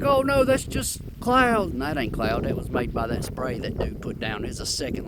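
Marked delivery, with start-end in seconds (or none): 0.0-1.7 higher, slow mock of the doubters; mocking skeptics. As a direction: parrot the naysayers with scorn. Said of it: "Everybody's like".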